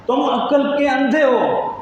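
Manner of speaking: preacher's full-force rebuke of the commander; preached rebuke. Acted. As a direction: hammer each word of the insult slowly. Companion rebukes the Roman commander.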